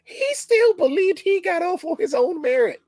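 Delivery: high-pitched, exasperated disbelief; incredulous. Real, not acted. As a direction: let the voice shoot up in disbelief. Exasperated rant about a hapless character.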